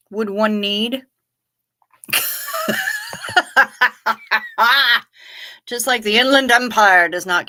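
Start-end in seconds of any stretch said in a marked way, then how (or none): 2.1-5.0 high, long peal of laughter; laughing. Real, not acted. Streamer cracking up on the stream, high laughter. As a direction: burst out in a high, extended laugh.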